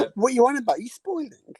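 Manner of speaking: what, spoiling? - outraged, climbing high; mock outrage at a spoiler. Real, not acted. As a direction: playfully accuse him of spoiling the film. Co-host hints at a spoiler.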